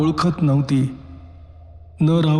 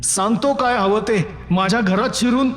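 higher, furious yell; angry shout; 6 semitones higher than usual. A narrator's reading. Husband yelling at the man in his house, furious. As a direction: charge at the intruder shouting. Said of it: "ओरडून म्हणालो".

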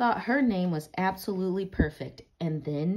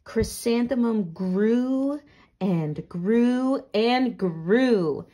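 slow, louder, stretching each grew; drawn-out storytelling; 5 semitones higher, 9 dB louder, much slower than usual. A narrator's reading. Reading aloud to a child, slow and louder. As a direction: let the growing go on and on.

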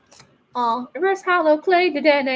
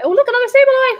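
high, loud mock squeal; hammed-up excitement; 14 semitones higher, 9 dB louder, faster than usual. Pulling a second Sableye for viewers, hammed-up squeal. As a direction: overplay the joy of a repeat pull. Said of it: "Sorry, I had to".

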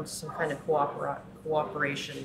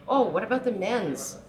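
high, loud sneering mimicry of a dismissive voice; mock-dismissive "oh" pitched high and loud; mocking scoff; 6 semitones higher, 6 dB louder than usual. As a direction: scoff at the question, playing the mocker. Mocking feminists dismissing men.

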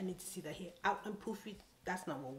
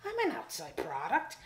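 high, louder sarcastic politeness; mock polite; 10 semitones higher, 6 dB louder than usual. Answering the stylist, high sarcastic politeness. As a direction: needle her with sweet sarcasm.

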